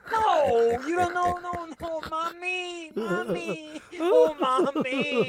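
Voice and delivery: high, drawn-out mock foreign voice; non-English-speaking neighbor. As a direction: whimper it high, imitating a confused foreign neighbor. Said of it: "didn't speak fucking English".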